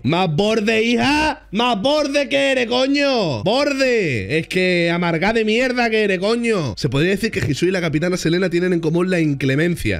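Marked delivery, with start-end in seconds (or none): 0.0-6.7 high, drawn-out exasperated rant; bronca exasperada. Real, not acted. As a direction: stretch the insults out, pitched high. Rants at the rude captain. (reproche exasperado y agudo)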